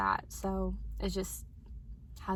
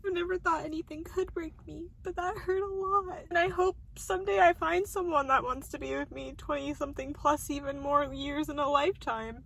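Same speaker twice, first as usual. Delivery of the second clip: crying; 5 semitones higher than usual. Real, not acted. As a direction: voice high and teary. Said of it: "I'm all emotional about it".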